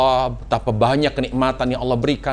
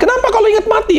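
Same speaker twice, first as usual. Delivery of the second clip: high, pressing questioner voice; questioner voiced; 4 dB louder, faster than usual. Preacher voicing someone asking Utsman why he weeps, high questioning voice. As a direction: ask it raised high like a curious companion.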